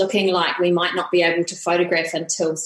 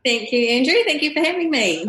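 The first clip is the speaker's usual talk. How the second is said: higher, with a laughing thank-you; 6 semitones higher than usual. Real, not acted. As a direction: giggle through the thanks. Guest laughs thanking the host.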